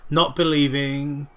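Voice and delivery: ordinary talk, nothing marked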